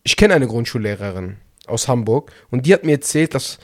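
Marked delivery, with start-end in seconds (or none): none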